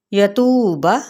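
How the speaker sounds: यतूबा with the बा kept short; tajweed demo, short बा; short final vowel. Shown as a demonstration. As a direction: say यतूबा without lengthening बा. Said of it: "बा के हरकत को लंबा नहीं करेंगे".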